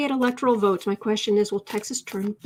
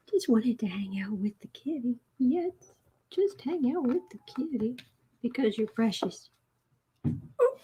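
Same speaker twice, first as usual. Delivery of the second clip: soft cooing; 6 dB quieter than usual. Real, not acted. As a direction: whisper sweetly to the kitty. Cooing at the cat.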